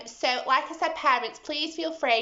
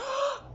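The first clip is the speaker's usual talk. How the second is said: gasp; 5 dB quieter than usual. Acted. Giantess startled.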